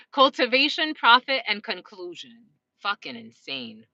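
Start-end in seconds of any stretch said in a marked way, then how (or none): 2.8-3.9 low, slow muttering; muttered aside. Real, not acted. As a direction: say it under your breath, slowly. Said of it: "repeated phrase, low and slow".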